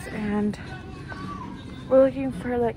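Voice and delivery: muffled behind fabric mask; speaking with covered mouth; through a cloth mask. Real, not acted. Seen 4: Patterned cloth mask covers her mouth and nose throughout.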